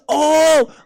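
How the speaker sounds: high, loud, drawn-out oh; loud acted "oh". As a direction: belt out a comic oh. Acted reaction on facing her.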